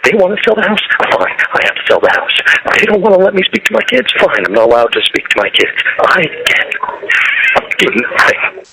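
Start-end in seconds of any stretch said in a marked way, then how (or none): 2.8-8.6 rising bitter outburst; angry. Real, not acted. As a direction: let it break out then sink. Angry jail call rant.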